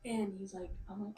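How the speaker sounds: usual speaking voice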